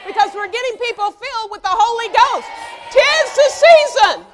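loud, high, preaching at full force; fiery pulpit shout. Real, not acted. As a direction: rouse the church with a shout. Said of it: "But why am I shouting".

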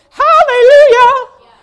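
loud, slow, drawn-out hallelujah shout; a laughing, overblown hallelujah; mock hallelujah. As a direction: whoop it like a giddy churchgoer. Mocking up-and-down believers.

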